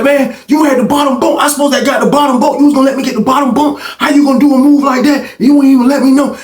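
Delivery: high-pitched acted protest; heated complaint. Inmate confronts another over the bottom bunk, heated complaint. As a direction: argue it fast and high, acting out the grievance.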